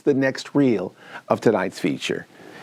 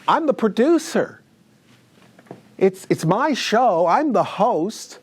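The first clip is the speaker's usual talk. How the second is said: petulant; 7 semitones higher than usual. Acted. Producer whining he's jealous.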